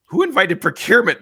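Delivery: high, loud indignant quote; loud mock outrage; retold alarm. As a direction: imitate someone aghast at an intruder. Retold alarm at surprise guest.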